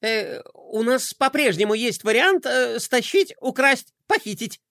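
nervous giggle. Acted. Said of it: "нервно хихикнул адъютант".

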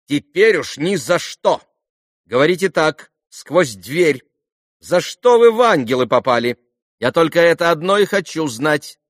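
high, loud, insistent demands; imperious young girl. A narrator's reading. Lise questions Alyosha through the door, high and insistent. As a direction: order him about with girlish stubbornness.

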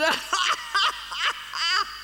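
peal of high laughter; high giggling laugh. Acted. [name] laughs at her own joke, high and giggly. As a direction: laugh in a high, rippling run.

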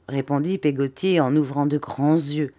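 usual speaking voice

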